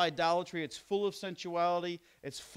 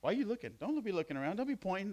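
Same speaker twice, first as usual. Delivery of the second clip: mock outrage; 4 semitones higher, 3 dB quieter than usual. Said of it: "No, I'm just kidding".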